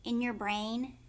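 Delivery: usual speaking voice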